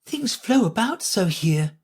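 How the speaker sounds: put-on high, plaintive girl voice; Alice complaining of the shop. A narrator's reading. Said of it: "in a plaintive tone".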